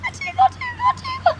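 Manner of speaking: high squeaky sing-song tickle. Pretend. Tickling the viewer with the air claw, squeaky playful tickle. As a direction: tease like tickling a child.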